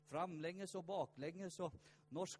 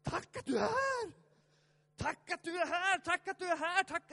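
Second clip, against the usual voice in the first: shouted thanks, much higher and louder; joyful thanks; 13 semitones higher, 11 dB louder than usual. Shown as a demonstration. Preacher showing the congregation how to shout thanks. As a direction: cry out tack like a jubilant worshipper. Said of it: "Han borde ha sagt tack".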